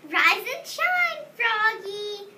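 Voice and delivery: a lightly voiced, slow cheerful dad; cheerful father. Father waking Froggy on school day, lightly voiced and slow. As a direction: wake him up brightly and slowly. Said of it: "said his father".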